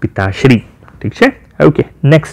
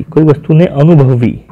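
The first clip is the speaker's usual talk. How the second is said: ordinary talk, nothing marked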